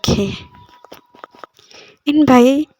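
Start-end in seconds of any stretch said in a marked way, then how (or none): 2.1-2.7 loud call across the room. Acted. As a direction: call him from a distance. Calling out to brother.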